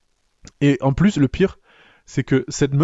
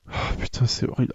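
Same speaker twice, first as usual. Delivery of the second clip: breathy whisper of horror; whispered dismay; 4 semitones lower, 7 dB quieter than usual. Real, not acted. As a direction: whisper it like a guilty confession. Whispered aside.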